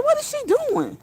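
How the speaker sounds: very high squeal of disbelief; mock holler. Streamer telling friend how she reacted to the clip, squealed mock holler. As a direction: squeal it like a retold holler, without shouting. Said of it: "Girl, I was hollering".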